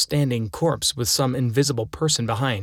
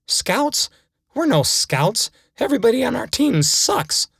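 much higher, incredulous rant; outraged kid; 8 semitones higher than usual. Acted. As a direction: build from disbelief into a mock tantrum. Kid realizes he was lied to.

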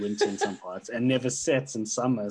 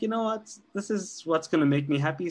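mock decisive; 7 semitones higher, 4 dB louder than usual. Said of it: "I was like".

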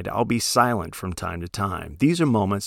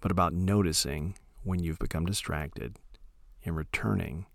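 soft, unhurried meditation voice with long pauses; calm, hushed instruction, letting each phrase trail into silence; guided meditation; 6 dB quieter than usual. Acted. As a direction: gently guide listeners into settling, phrase by phrase.

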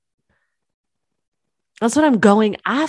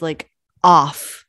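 normal talking voice

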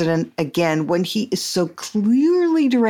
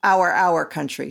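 ordinary delivery

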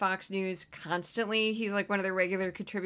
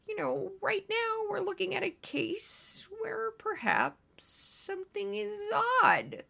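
much higher, softer, slow and strange legal analyst voice; Greg Jarrett impression; 11 semitones higher, 4 dB quieter, slower than usual. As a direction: do an odd, robotic legal analyst. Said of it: "It's my Greg Jarrett".

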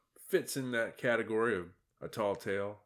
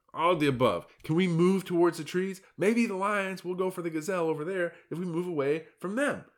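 higher, urgent warning voice; a fretful zebra pleading to be heard; exasperated herd lookout; alarmed zebra; 8 semitones higher, 6 dB louder than usual. Acted. Hector urges herd away.

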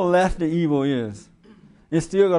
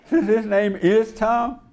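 higher, slow, through laughter; laughing; 5 semitones higher, slower than usual. Real, not acted. Host explaining his Uncle Tom joke, laughing. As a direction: laugh through the words, drawing them out.

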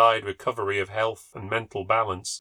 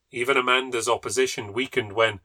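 ordinary delivery

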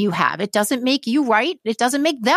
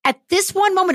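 moved, voice lifting higher; choked up; 6 semitones higher than usual. Real, not acted. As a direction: voice climbing high with real emotion. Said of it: "so choked up".

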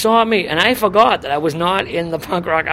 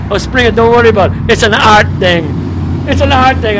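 loud, higher-pitched dismissal; loud brush-off; 4 semitones higher, 7 dB louder than usual. Retold or imitated. Host brushing off the young punk's objection, loud and dismissive. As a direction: wave the worry away at full voice.

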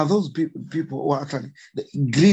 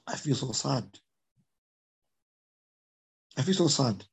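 softer, sad admission, twice over; emotional; 2 semitones lower, 7 dB quieter than usual. Real, not acted. Conservationist tells interviewer of park damage, softly sad. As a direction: let the sadness quietly break through. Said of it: "So I'm becoming emotional".

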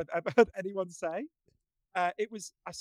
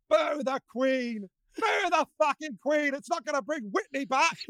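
high, slow shouted taunt at the crowd; defiant shout; 8 semitones higher, slower than usual. Retold or imitated. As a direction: fling it back at the booing audience. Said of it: "she just goes".

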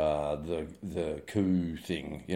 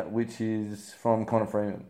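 ordinary talk, nothing marked

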